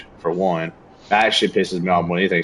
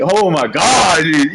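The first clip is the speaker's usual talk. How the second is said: slow, high, louder groan of exasperation; exasperated; 6 semitones higher, 4 dB louder, much slower than usual. Retold or imitated. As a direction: drag it out, fed up. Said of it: "I'm like".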